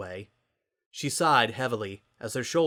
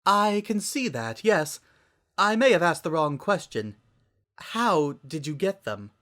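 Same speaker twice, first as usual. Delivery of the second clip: higher, careful and slow; gently insistent Rarity; 7 semitones higher, 3 dB louder than usual. A narrator's reading. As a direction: press kindly for the truth. Rarity concerned.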